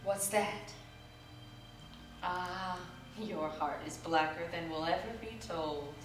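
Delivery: usual speaking voice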